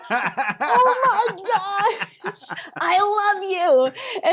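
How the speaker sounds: slow, laughing gush of joy; overjoyed, half laughing it out; laughing delight. Retold or imitated. Delighted by the star's secret offer.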